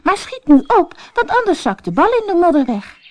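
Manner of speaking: high, loud and fast; impatient princess. A narrator's reading. Princess urges the frog to dive, high and fast. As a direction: hurry him, snappish and quick.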